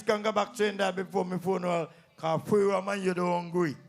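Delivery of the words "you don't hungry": ordinary talk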